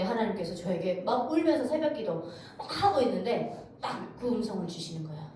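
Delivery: usual speaking voice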